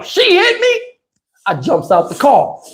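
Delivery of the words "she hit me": 1 outraged recognition. Retold or imitated. Recognizing the driver.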